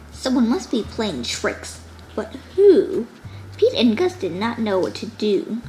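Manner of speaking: ordinary delivery, nothing marked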